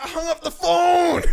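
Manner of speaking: high, loud reveal, last word stretched; gleeful mock shout. Boss pointing at the friend, revealing the joke, shouting. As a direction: crow it out as a prank punchline.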